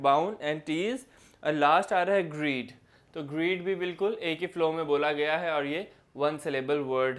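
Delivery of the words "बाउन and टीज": बाउन and टीज slowly, as two separate chunks; split into syllables. Shown as a demonstration. Teacher recapping a two-syllable word, बाउन and टीज broken slowly in two. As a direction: say Bhawan, then टीज, each syllable on its own. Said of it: "इसमें दो breaks आ रहे हैं".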